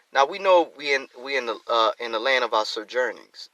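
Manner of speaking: ordinary talk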